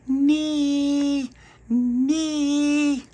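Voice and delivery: put-on very high babyish squeal; a toddler copying the big kids; baby calling. Baby shouts through letterbox.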